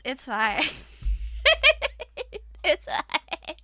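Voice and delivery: high, loud laugh into a giggled reassurance; high laughter. Real, not acted. Streamer laughing off the insult chat understood, giggling.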